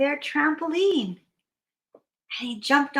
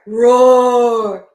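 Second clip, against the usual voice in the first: loud, long monster roar; Yeti roar; 2 semitones lower, 9 dB louder than usual. Acted. Yeti woken from a nap, loud roar.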